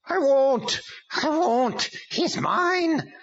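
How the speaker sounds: high, loud demon scream of refusal. A narrator's reading. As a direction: shriek it out like a cornered demon.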